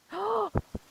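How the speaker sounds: high gasp of delight; sharp excited gasp. Real, not acted. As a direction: gasp in sudden joy. Spots favorite character.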